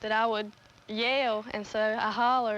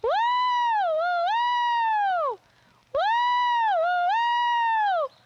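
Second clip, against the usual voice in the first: very high, louder yodel-like woo; dog-calling holler; 5 dB louder than usual. Shown as a demonstration. Calling the dogs to come to her, high loud holler. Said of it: "there's a holler which goes like this".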